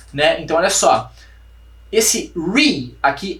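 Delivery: ordinary talk, nothing marked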